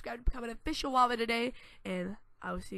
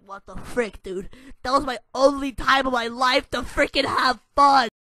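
loud, indignant complaint; mock outraged kids; 4 semitones higher, 17 dB louder than usual. Kids complaining they lost blacktop time, loudly indignant. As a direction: whine it out like cheated kids. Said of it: "Everybody's just like".

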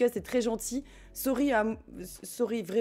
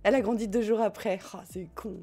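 ordinary talk

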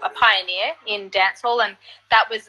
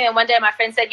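ordinary talk

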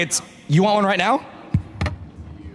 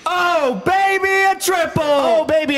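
hype shout; 8 semitones higher, slower than usual. Real, not acted. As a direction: announce it like a game-show host. Host hyping a triple shot.

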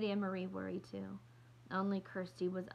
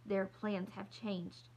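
usual speaking voice

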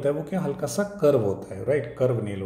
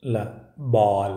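slow sounding out; much slower than usual. Shown as a demonstration. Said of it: "क्या है?".

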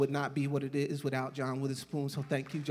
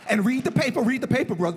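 mock mentor; 6 semitones higher, 5 dB louder, faster than usual. Imitating John's lazy riches.

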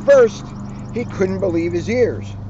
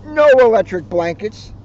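louder, firm refusal; farmer's refusal; 5 semitones higher, 6 dB louder than usual. Acted. Farmer refuses the cows' blankets: firm loud no. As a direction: lay down the law. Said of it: "said Farmer Brown".

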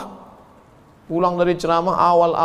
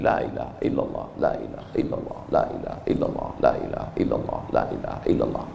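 soft unvoiced whisper of la ilaha illallah; whispered dhikr; 8 dB quieter, faster than usual. Shown as a demonstration. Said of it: "ambil tasbih".

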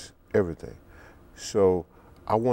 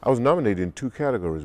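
ordinary talk, nothing marked

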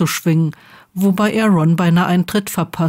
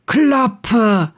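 higher-pitched call, only mildly raised; 5 semitones higher than usual. A narrator's reading. Seamus shuts them up from his bed, calling out. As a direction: call out irritably without really shouting. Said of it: "rief Seamus aus seinem Bett".

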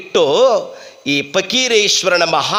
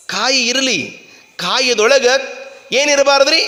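ordinary talk, nothing marked

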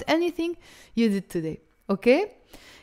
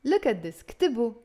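usual speaking voice

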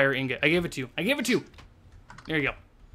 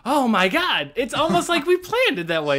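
high, loud delighted exclamation; excited amazement; 7 semitones higher, 6 dB louder than usual. Real, not acted. Gamer admiring the finished build, excited amazement. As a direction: burst out high and thrilled.

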